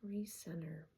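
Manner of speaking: hushed, breathy near-whisper; soft and airy, settling yourself to recenter; taking a breath. Real, not acted. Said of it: "gonna just take a breath here".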